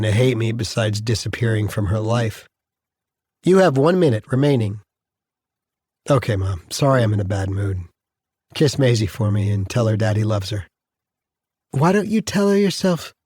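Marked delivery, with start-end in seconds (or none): 3.4-4.8 lightly voiced phone system; automated announcement. Phone system warns the prisoner, lightly voiced announcement. 11.7-13.1 higher-pitched voice of a mother; mother's voice. Acted. Mother urging son to talk to his daughter, higher mother's voice. Grandmother urges dad to talk.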